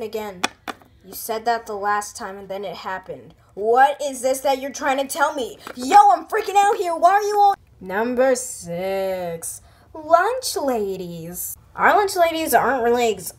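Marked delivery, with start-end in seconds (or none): 5.8-7.5 freaking out. Acted. Kid panicking.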